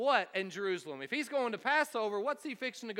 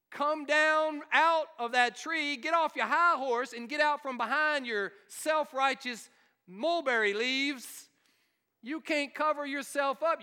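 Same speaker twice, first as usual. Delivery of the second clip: preaching call; 4 semitones higher than usual. Acted. Pastor urging the congregation down from their high horse, preaching call. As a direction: preach it straight at them, come down.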